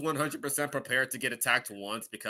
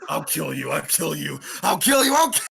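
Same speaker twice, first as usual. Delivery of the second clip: murderous battle frenzy; 3 semitones higher, 8 dB louder than usual. Acted. Player voices a character attacking a monster, murderous frenzy. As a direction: build into frenzied, hammed-up killing threats.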